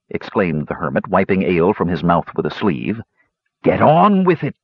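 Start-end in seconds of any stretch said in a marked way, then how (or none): 3.6-4.6 higher, slightly louder, urgent prodding; impatient demand. A narrator's reading. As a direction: press him to hurry up. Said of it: "demanded the impatient shopkeeper".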